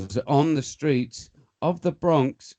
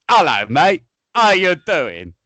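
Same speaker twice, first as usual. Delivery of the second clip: much higher and louder, a chirpy mock-posh British hello; posh English greeting; 4 semitones higher, 10 dB louder, slower than usual. UK lad in the Bronx, greeting the locals in a loud, high, cheery mock-posh voice. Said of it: "I was like that".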